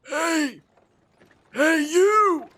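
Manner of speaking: put-on high, creaky old codger calling out; old man voice. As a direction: play a rustic old man checking on her. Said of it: "I'm gonna give him an old man voice".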